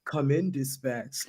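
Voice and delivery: slow low radio call; imitating the zombie caller. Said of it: "Then he goes".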